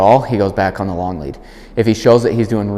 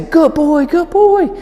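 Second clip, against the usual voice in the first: much higher, bright praise voice; high encouraging praise; 4 dB louder, slower than usual. Shown as a demonstration. Trainer encouraging the dog coming back, high praise. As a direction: cheer the dog on, high and excited. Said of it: "I'm gonna encourage".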